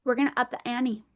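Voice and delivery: ordinary talk, nothing marked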